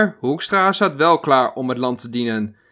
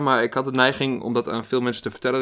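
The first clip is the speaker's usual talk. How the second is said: normal talking voice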